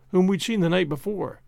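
ordinary delivery, nothing marked

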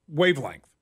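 normal talking voice

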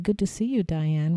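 ordinary talk, nothing marked

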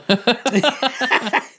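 high loud laugh breaking out; loud burst of laughter. Real, not acted. Laughing at his own slip about shaking Dick. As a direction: crack up at the slip.

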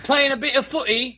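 high-pitched mock British accent. Shown as a demonstration. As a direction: put on a cheeky English football-fan accent. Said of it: "several different, you know, dialects".

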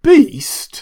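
high, loud mocking question; scornful echo. A narrator's reading. Drunk doctor throwing the word back, high and scornful.

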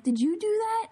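high-pitched accusing question; incredulous accusation. Retold or imitated. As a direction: shoot up high in mock disbelief. Confronting friends in retold story.

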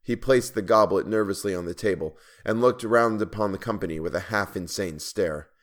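plain reading voice

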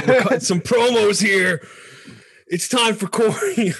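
high, loud, slow ring announcer. Pretend. Introducing Corey's promo like announcer.

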